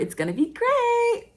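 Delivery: high-pitched giddy singsong; excited. Real, not acted. Host promoting her October shows, giddily excited. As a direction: let it soar up high with excitement. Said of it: "I get so excited".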